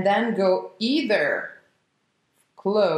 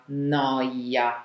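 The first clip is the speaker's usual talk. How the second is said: ordinary delivery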